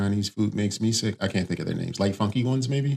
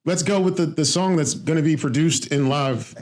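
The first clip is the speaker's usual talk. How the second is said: high, excited announcer voice; hyped announcer; 7 semitones higher than usual. Acted. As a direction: build it up like a big reveal. Mock-grand song announcement.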